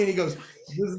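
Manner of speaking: ordinary talk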